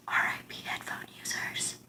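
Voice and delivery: hushed whisper. Real, not acted. Apologizing to headphone viewers, whispering. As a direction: breathe it to the listeners. After loud demo, whispers.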